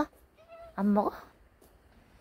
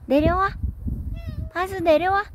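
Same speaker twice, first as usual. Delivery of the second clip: calling out; 8 semitones higher, much faster than usual. Real, not acted. Calling cat down from above.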